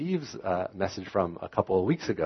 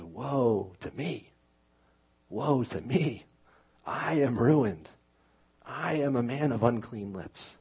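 slow, higher, a grieving cry of woe against himself; lamenting cry; 5 semitones higher, slower than usual. Acted. Pastor voicing Isaiah's cry of woe on himself, grieving and slow. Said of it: "He says".

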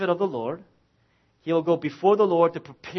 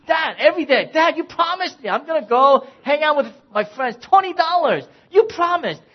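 higher, louder nagging son voice; pushy son whining for his money, higher and louder; pestering kid; 8 semitones higher, 6 dB louder than usual. Retold or imitated. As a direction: nag like a kid who won't let it go. Son pestering dad daily.

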